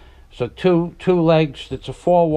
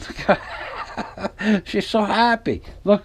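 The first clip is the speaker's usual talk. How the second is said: laughing through the words; giggling while teasing; 6 semitones higher, 4 dB quieter, slower than usual. Real, not acted. Teasing wife on camera, laughing.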